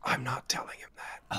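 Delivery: hushed whisper. Real, not acted. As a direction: keep it under your breath. Player whispers back.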